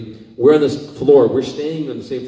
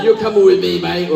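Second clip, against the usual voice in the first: rugby guys' playful order; 5 semitones higher, 4 dB louder than usual. Retold or imitated. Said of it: "They're like".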